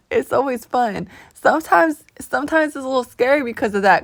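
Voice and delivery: normal talking voice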